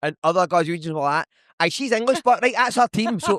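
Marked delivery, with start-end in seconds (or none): 1.6-3.2 high-pitched animated quote; animated mock reaction. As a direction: act out the pub lads piping up. Acts out other pub-goers' reaction.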